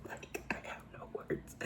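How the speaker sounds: a drained, breathless whisper; barely voiced disbelief; stunned whisper. Real, not acted. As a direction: whisper it, lost for words. Stunned after the rant.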